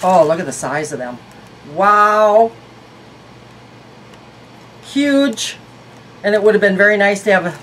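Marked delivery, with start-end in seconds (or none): 1.7-5.6 amazed. Real, not acted. Sees size of glasses.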